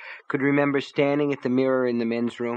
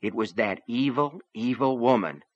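plain reading voice

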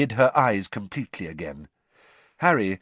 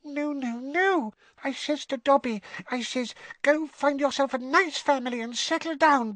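very high squeaking voice; a house elf meeting someone famous; tiny elf squeak. Acted. Winky introducing herself to Harry, awed squeak. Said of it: "a teeny quivering squeak of a voice".

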